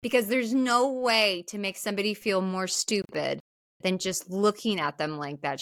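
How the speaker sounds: ordinary talk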